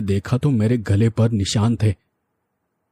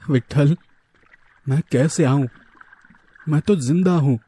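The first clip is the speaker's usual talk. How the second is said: higher, mildly panicked protest; frightened, tearful; 5 semitones higher than usual. A narrator's reading. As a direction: plead back with a touch of tearful fear. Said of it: "मैं घबरा कर रोने लगी". (घबराई, हल्की रुआँसी, ऊँची आवाज़ में)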